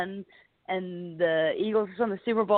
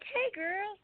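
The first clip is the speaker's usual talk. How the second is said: much higher, softer playful greeting; flirty greeting; 13 semitones higher, 7 dB quieter than usual.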